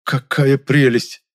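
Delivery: slow, loud admiring exhale; breathless delight. Acted. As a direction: breathe it out in awe. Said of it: "я восхищённо выдохнул".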